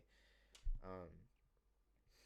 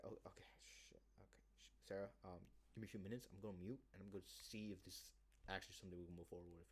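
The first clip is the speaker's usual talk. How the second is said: mock hushed aside; 2 semitones lower, 10 dB quieter than usual. Pretend muted aside.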